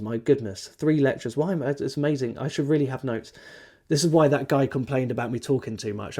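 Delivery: normal talking voice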